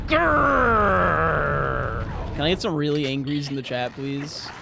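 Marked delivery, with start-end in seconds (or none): 0.1-2.0 a loud playful cartoon growl; mock growl. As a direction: growl like a pretend-angry kitten. Asks chat for angry emotes.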